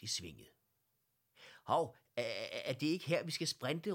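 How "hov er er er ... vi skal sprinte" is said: loud, high, stammered start; called-out question. A narrator's reading. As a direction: call out in sudden doubt, rising high. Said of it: "råbte Bloms". (højt råbt, hakkende spørgsmål)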